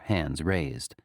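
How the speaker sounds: usual speaking voice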